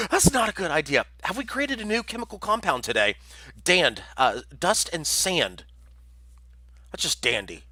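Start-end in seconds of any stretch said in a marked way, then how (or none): 4.6-5.6 careful slow. Shown as a demonstration. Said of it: "I'm trying to pronounce dust and sand".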